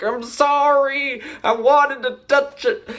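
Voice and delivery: mock-pitiful high apology; whiny apology. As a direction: sound like a scolded kid. Hero scolded, apologizes.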